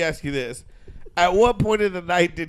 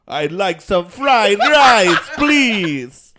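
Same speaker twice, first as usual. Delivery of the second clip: loud, slow, high hammed-up Chinese accent; mock Chinese accent; 4 semitones higher, 8 dB louder, much slower than usual. Shown as a demonstration. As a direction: overdo a Chinese accent, stretching the words. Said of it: "here's my best Chinese accent".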